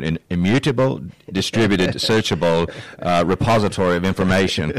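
lightly put-on Irish accent, half joking; bad Irish accent. Host jokingly reads tech jargon in a bad Irish accent. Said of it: "we'll say it in, in an Irish tone".

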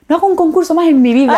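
high, loud retold outburst; hammed-up exclamation with a laugh in it; mock exasperated vow. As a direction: swear it off dramatically, half laughing. Retold vow right after the contest.

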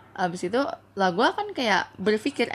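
usual speaking voice

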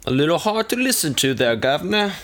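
high, put-on Cockney; Cockney accent. Co-host teasing about a hard-to-follow accent, mock Cockney. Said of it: "Well, Cockney".

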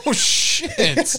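high laughing burst of disbelief. Real, not acted. Laughing as a friend hears the guy got dropped, high laughing exclaim. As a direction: laugh it out in shock.

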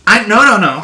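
flustered, loud, high no-no-no; laughing denial, caught red-handed; excited protest. Real, not acted.

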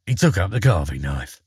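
plain reading voice

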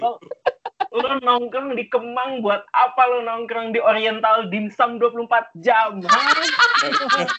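high, indignant mock rant; mock scolding. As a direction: grill them, ending on a squeaked challenge. Acted scolding of South Jakarta kids.

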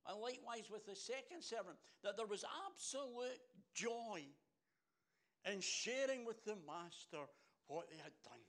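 exultant, emphatic delivery; joyful preaching. Acted. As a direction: preach the joy at full force. Preacher at full force on joy.

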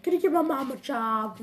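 pitched high, sweet asking tone; girly mock voice. Girl asking Richie for her mom's job, high and sweet. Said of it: "she's like".

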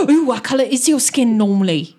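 gasp, then ask it with fascination; excited gasp. Acted. Gasps and repeats the question excitedly.

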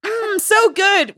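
high, slow savouring voice; mock satisfied diner. Customer eating, mocked.

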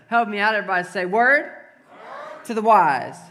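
loud call to the crowd; leading a call-back. Real, not acted. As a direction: shout it out for them to repeat.

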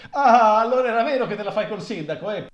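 loud, fast gotcha; gleeful accusation. Acted. A mourner loudly accusing the widow of an affair with the mayor. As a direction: catch her out with glee.

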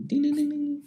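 high sing-song ding ding; bell dings. Retold or imitated. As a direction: chime like sleigh bells, up high. Mimicking jingle bell sounds.

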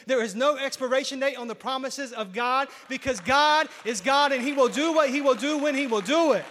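loud, high building preacher's cry; full-voiced sermon peak; preaching at full force. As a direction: drive it home at the climax. Climax of the sermon.

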